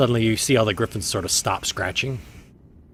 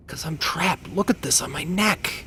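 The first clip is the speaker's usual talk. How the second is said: higher creature voice; 9 semitones higher, 5 dB louder than usual. Acted. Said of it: "He says".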